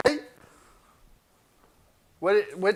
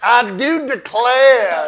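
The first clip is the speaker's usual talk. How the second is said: slow hammy Southern gentleman, laughing; Southern drawl; 7 semitones higher, 13 dB louder, much slower than usual. Acted. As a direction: drawl it like an old Southern gent.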